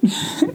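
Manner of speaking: short quiet sob catching in the throat; a sob escapes; quiet broken sob; choked sob. Real, not acted. Breaks down mid-story.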